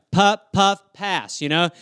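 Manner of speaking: ordinary talk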